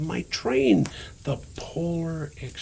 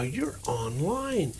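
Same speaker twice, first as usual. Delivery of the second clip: ordinary delivery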